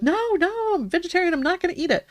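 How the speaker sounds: put-on high, pleading boy's voice; whiny kid refusal. Son Robbie refuses the rat.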